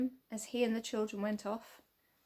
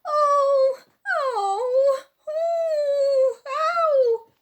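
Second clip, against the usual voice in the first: high, loud cries of pain; a man out of sight crying out in pain; yelling in pain; 10 dB louder, much slower than usual. A narrator's reading. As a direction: howl out oh and ow as if being hurt. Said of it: "he began to yell".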